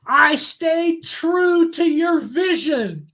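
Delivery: high, loud and very slow smug director voice; weasely Hollywood director. Retold or imitated. Director boasting to the author after the premiere, slow and smug. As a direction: drag each word out like a self-important little Hollywood guy. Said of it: "the director's like a little Weasley Hollywood guy".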